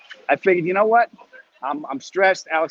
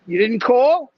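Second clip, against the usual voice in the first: higher, louder mock accusation; accusing mother; 10 semitones higher, 7 dB louder, slower than usual. Mother scolding her son for not calling, higher and louder. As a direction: nag like a guilt-tripping mom. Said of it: "She goes".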